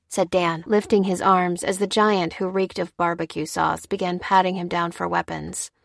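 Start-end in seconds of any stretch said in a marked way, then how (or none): none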